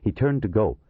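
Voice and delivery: usual speaking voice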